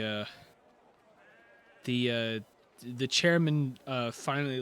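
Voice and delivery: ordinary delivery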